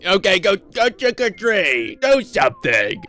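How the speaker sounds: loud, strained creature shout; demanding pumpkins. Acted. Pumpkins pushing kids to trick-or-treat, demanding. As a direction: order them around in a hammed-up monster voice. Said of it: "the pumpkins are demanding".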